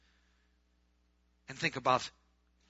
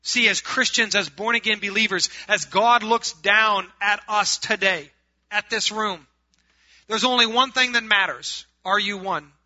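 loud, higher-pitched sermon delivery; full-force preaching; 7 semitones higher, 13 dB louder than usual. Acted. Preacher telling his congregation Jesus rejects the lukewarm, full-force preaching.

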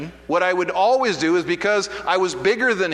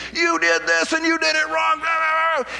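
boss yelling; 10 semitones higher than usual. Retold or imitated. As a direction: bark it out like an angry foreman. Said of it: "he starts yelling at me".

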